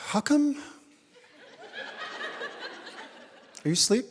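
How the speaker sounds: mock the wife springing a topic in bed; pouty mock wife voice; wife's needy question. Wife raising old grievance.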